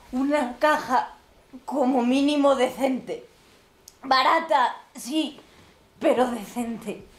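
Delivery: slow, deliberate, dignified words; slow insistence. Acted. Old mother asking for a decent coffin for her son, slowly. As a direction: lay out each word with pride.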